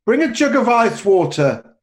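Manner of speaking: calls out loud and high toward the back room; shouting to the back room. Acted. Host shouting to the servants in back, loud call. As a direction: bark an order for ice water and vodka. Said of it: "turns towards the back room and shouts".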